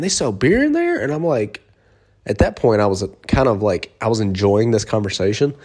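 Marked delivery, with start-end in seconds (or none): 0.0-1.0 high, astonished question; imitating a naive stranger; mock surprise. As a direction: sound amazed. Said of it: "And he was like".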